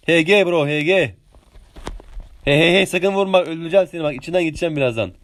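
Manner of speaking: ordinary delivery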